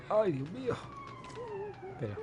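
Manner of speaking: ordinary delivery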